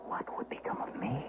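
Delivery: hushed, breathless whisper of dread; fearful whisper. A narrator's reading. Hidden shepherd whispering his fear of being found. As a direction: whisper it as if hiding from them. Narrator's fearful thought in hiding.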